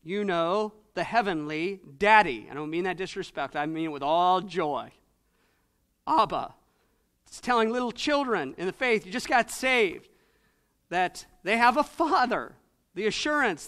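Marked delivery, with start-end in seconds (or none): none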